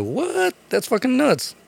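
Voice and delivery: very high amazed squeal. Retold or imitated. Man learning racing came from moonshiners, high amazement.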